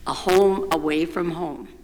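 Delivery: ordinary delivery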